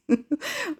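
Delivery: quick high laugh; short high amused giggle; high giggle burst. Real, not acted.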